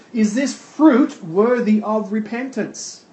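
ordinary delivery

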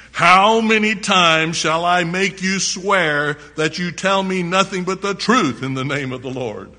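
king fed up with the prophet; exasperated demand. Acted.

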